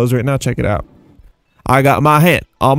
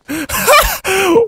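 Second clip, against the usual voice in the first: high surprised laugh. Real, not acted. Surprised by tiny model.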